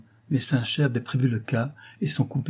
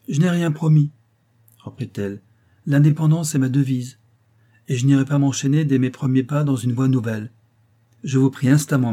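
raised, insistent plea to the gentlemen; Rose, proud and firm; 4 semitones higher, 6 dB louder than usual. A narrator's reading. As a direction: stand on your independence, voice up and loud. Rose addresses dinner guests.

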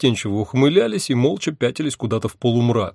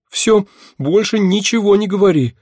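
higher, louder, urgent and awestruck; terrified hush; 10 semitones higher, 6 dB louder than usual. A narrator's reading. She silences the demon in awed terror, loud and high. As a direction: cut him off in holy dread.

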